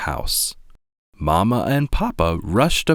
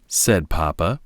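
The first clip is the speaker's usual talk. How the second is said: usual speaking voice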